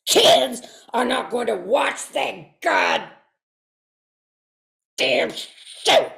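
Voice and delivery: put-on loud angry rant at the kids; furious old man yelling at the children; angry shout. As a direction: bark it like an outraged veteran. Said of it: "Doctor Dave would always interrupt".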